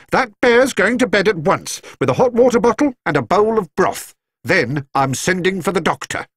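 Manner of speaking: put-on high, indignant elderly lady's voice; a scandalised housekeeper, voiced well up; shocked housekeeper. A narrator's reading. Mrs Bird voiced, taking charge.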